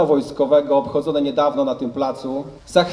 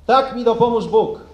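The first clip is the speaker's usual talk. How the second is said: formal oath reading; 4 semitones higher than usual. Real, not acted. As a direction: dictate the oath solemnly for troops. Officer reads oath for repeating. (uroczyste odczytywanie przysięgi)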